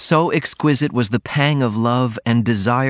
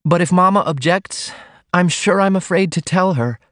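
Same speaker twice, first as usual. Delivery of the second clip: put-on high, worried young woman; apprehensive heroine; 9 semitones higher than usual. A narrator's reading. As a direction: fret about mother in a lifted voice. Heroine fears telling her mother.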